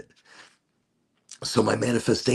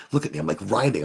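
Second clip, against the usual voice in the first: ordinary delivery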